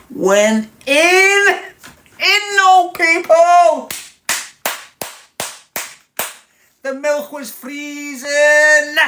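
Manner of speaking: very high, loud comic yelling; excited shouting. Retold or imitated. Man retelling his Weetabix feast, shouting excitedly. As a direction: shriek it in hammed-up triumph.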